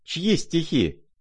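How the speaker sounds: high, drawn-out eager question. A narrator's reading. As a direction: ask it high and slowly, in delight. Rapt general's wife demands the poet. (восторженный вопрос высоким голосом)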